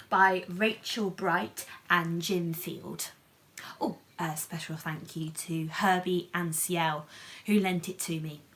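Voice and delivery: usual speaking voice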